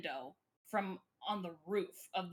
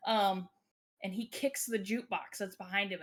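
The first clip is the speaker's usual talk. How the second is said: usual speaking voice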